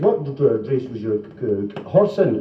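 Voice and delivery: comic stutter on D and C; halting question with stuck consonants; mock stammer; stammering boss.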